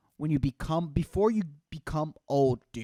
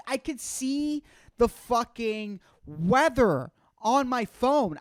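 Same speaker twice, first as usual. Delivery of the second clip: usual speaking voice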